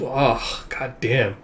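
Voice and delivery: usual speaking voice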